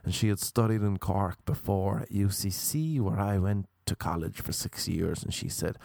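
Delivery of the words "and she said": ordinary talk, nothing marked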